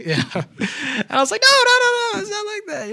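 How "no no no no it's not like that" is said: high, hurried no no no; frantic denial. Retold or imitated. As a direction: rush to reassure him, pitched way up. Frantic reassurance retold.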